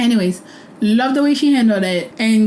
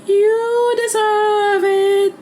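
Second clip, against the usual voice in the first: smug gloating; 10 semitones higher than usual. Retold or imitated. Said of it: "And I'm like".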